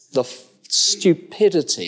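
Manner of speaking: usual speaking voice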